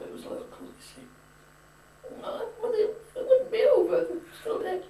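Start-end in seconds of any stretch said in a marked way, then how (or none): 2.2-4.8 put-on high, pleading teenage girl with a half-laugh; protesting daughter. Daughter protesting father's curfew.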